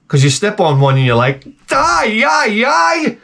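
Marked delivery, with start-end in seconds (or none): 1.7-3.2 high shrieking mock panic; hammed-up high-pitched scream; panicked yelp. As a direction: squeal like you stepped on a landmine. Stepping on crab, panicked scream.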